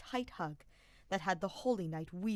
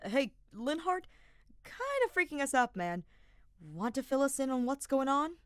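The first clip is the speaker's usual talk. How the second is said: nervous gulp; 7 semitones higher, 6 dB louder than usual. Acted. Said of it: "Sylvain gulped".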